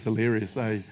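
ordinary delivery

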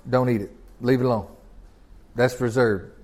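soft, low and grave; quiet, weighty voice of God forbidding; hushed solemn command. Acted. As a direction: lay down the rule quietly like God. God's command quoted softly.

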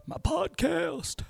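high squeaky cartoon voice asking a question; squeaky alter ego. Talking to himself as a squeaky sidekick, high squeaky voice. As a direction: pitch way up into a silly squeak. Self-dialogue in a squeaky character voice.

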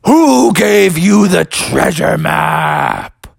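theatrical spooky interrogator; scary voice. Acted. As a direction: draw it out to intimidate an intruder. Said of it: "your voice is pretty scary".

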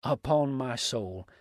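slow, mock-astonished exclamation; exclaimed. A narrator's reading. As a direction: draw it out as an indignant oath. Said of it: "cried Dix".

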